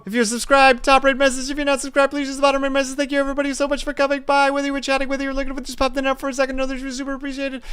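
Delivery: high, fast announcer sign-off; streamer outro. Acted. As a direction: rattle off the outro.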